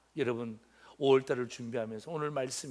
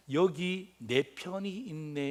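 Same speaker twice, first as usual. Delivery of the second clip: slow, spelled-out meaning; much slower than usual. Shown as a demonstration. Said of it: "이렇게 해석했어요".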